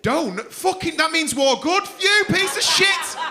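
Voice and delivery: high, shouted telling-off; mock outrage. Comic scolds Alex over war answer, mock outrage. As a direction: explode in comic indignation.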